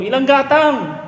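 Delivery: henpecked husband asks wife how much rice, high timid voice; meek husband squeak. Retold or imitated. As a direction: shrink into a henpecked husband asking.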